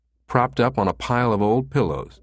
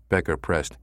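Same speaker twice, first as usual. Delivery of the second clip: ordinary delivery, nothing marked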